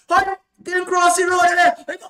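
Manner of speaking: loud, high taunting call from a car; a driver hollering out the window; mock yelling driver. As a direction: yell it like a driver mocking him across the road. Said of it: "and a man just said".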